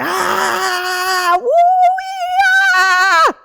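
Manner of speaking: high, drawn-out whooping yells; wild yelping dance. Retold or imitated. Telling partner how she shook off the hit, wild whoops. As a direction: yelp it out like a shaking dance. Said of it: "do a little dance".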